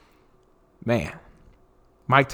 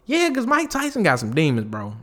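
amused agreement; 6 semitones higher than usual. Retold or imitated. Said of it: "And I'm like".

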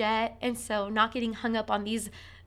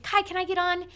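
put-on high, raised child's voice; imitating a child; 9 semitones higher, 4 dB louder than usual. Boy asking his brother for a turn, imitated high child voice. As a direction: play the eager little boy. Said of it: "And so he said".

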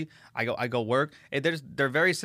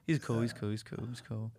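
softer, dismissive mumble of yeah yeah, he's cool; offhand, quieter brush-off to a fan; bored brush-off; 3 semitones lower, 9 dB quieter than usual. Retold or imitated. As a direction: wave them off like it's no big deal. His bored reply to guests.

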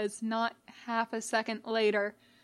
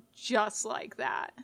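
plain reading voice

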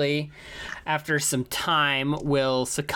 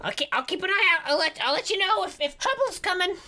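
high goblin voice; 13 semitones higher than usual. Acted. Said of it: "he's looking around".

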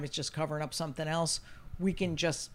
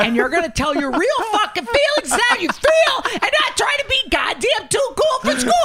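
high and loud, rising to a yell; hammed-up furious shout; building shout; 9 dB louder than usual. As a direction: bellow it at the kid. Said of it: "I go".

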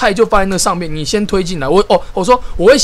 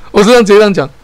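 usual speaking voice

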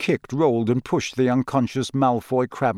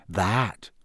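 dark, lowered single word; 4 semitones lower, 4 dB quieter than usual. A narrator's reading. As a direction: drop it low with grim weight. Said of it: "said George darkly".

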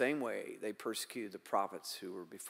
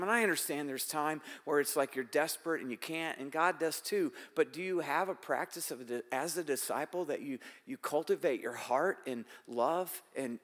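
ordinary delivery

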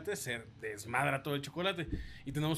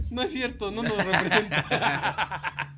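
mock denial; 5 semitones higher, 7 dB louder, much slower than usual. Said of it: "Y yo".

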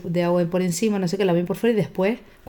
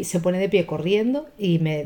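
ordinary delivery

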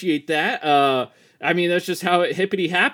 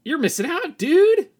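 eager dude; 11 semitones higher, 4 dB louder than usual. Acted. Eager about the killing.

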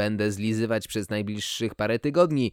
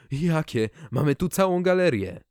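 eager; 5 semitones higher than usual. Acted. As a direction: ask eagerly, voice lifting. Eager owner.

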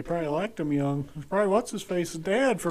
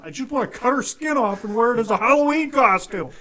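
high, loud mock rant; imitated angry outburst; 7 semitones higher, 10 dB louder than usual. Imitating a friend raging about his wife, loud exasperation. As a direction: build to an exasperated comic outburst.

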